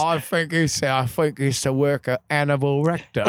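thick mock English accent; unintelligible British constable. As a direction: garble it like a British policeman. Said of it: "could you translate what he's saying".

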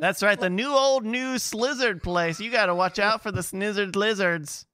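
put-on knowing old-timer New England voice; mock New England accent. [name] as New England local telling visitors about Snizzard lizards. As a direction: tip them off like a crusty New England local.

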